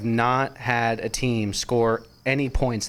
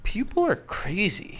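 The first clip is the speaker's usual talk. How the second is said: exasperated; 10 semitones higher, 4 dB quieter, slower than usual. Retold or imitated. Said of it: "I'm like".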